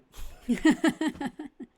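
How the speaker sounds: high-pitched burst of laughter. Real, not acted. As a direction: laugh at the joke. After acted exchange.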